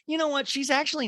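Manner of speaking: high, sing-song fake sincerity; high mock voice. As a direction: gush with put-on sincerity. Mock change of heart.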